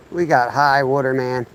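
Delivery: ordinary talk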